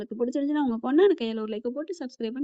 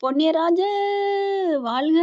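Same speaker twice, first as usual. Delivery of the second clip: loud, high cheer of praise; shouted cheer; 6 semitones higher, 4 dB louder than usual. Retold or imitated. Grateful husband hails the police officer, loud shouted cheer. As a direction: hail him at the top of your voice. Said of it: "சொல்லி கத்துறாரு".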